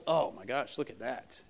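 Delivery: low, hushed disdainful aside; mock a judgmental onlooker under your breath; quietly scandalized, dropping low; looking down on others. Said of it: "looking down our noses at them".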